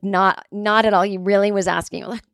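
normal talking voice

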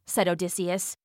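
ordinary delivery, nothing marked

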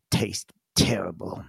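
low grumpy whisper. Acted. Grumpy goblin complaining about beans, low whisper. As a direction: mutter it under his breath, sulking. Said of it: "looks grumpy and folds his arm".

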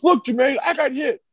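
high, whiny mock complaint; mocking Mike Brown. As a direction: pout and whine about the lip. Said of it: "gets in the middle of the two and goes".

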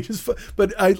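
normal talking voice

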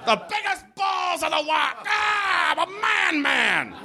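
high shouted macho bravado; hammed-up manly roar; macho yell. Comic riffing on a statue's manhood, hammed-up shout.

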